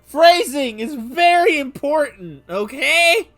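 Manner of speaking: high, loud, slow exasperated rant. Acted. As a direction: rant it out, half laughing. Ranting about the phrasing.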